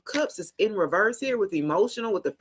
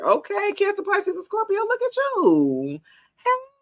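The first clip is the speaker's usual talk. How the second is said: bright, much higher, delighted call-out; excited; 11 semitones higher than usual. Real, not acted. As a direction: cheer them on like great news just landed. Delighted by a good tarot card.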